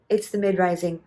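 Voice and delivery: ordinary talk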